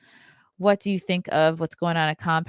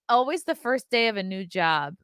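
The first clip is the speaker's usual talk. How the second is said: ordinary delivery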